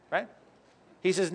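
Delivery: ordinary talk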